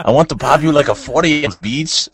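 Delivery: high put-on Mexican-accented growl; a sleazy Mexican Chewbacca hitting on a woman; hammed-up high comic pickup voice. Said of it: "I could do it".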